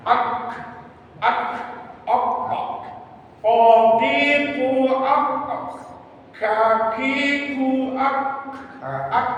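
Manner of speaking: clipped ak, ok syllables, slow and high; articulation drill. Shown as a demonstration. Teacher drilling the students on the back k sound, slow high syllables. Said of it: "demonstrating k articulation".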